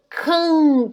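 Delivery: "can" with the a sent out through the nose; nasal can. Shown as a demonstration. Teacher demonstrating nasal "can" for students. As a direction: let "can" ring in the nose. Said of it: "sai pelo nariz".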